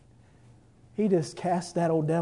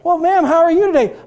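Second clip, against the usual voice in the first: much higher, louder polite greeting; blind man greeting; 12 semitones higher, 11 dB louder than usual. Acted. Blind man politely asking the healed woman how she is. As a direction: greet her warmly as a gentleman.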